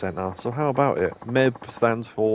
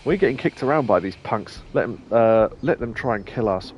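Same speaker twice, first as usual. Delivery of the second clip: ordinary talk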